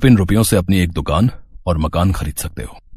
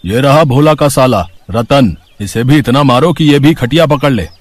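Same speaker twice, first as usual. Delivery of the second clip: thuggish shout; 6 semitones higher, 5 dB louder than usual. Acted. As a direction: bark it out like a street bully. Thug orders goons to beat Ratan. (गुंडे की ऊँची धमकी)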